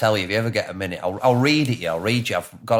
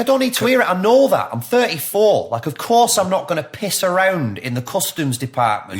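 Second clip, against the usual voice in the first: high, raised-voice protest; exasperated retort; 8 semitones higher, 4 dB louder than usual. Retold or imitated. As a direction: push back indignantly, pitch right up. Acting out exasperated retort to warning.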